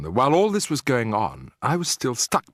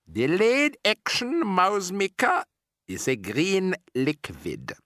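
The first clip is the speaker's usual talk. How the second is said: high, slow witch voice; a sinister foreign witch boss; Grand High Witch; 7 semitones higher, slower than usual. Acted.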